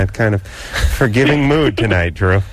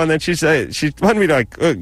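ordinary talk, nothing marked

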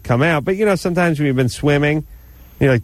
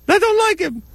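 ferret voice. Pretend. Vagina puppet argues with woman.

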